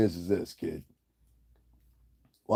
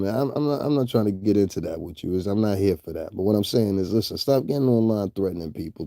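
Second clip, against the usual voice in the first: ordinary delivery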